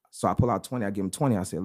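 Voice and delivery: ordinary talk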